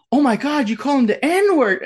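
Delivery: high-pitched, scandalized imitation; mock shock. Acts out shocked bystander.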